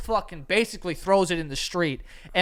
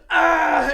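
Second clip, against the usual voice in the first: much higher, louder cry of pain; pained yelp; 11 semitones higher, 8 dB louder than usual. Retold or imitated. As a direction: yelp as if just hitting the floor. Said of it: "he's like".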